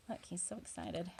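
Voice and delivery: plain reading voice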